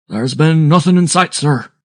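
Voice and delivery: high, loud sailor's reply; calling back. A narrator's reading. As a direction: call the report back across the deck. Captain answering across the deck.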